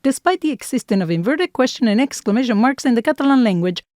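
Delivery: loud, fast disclaimer read; mock legal disclaimer. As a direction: rattle it off like official small print.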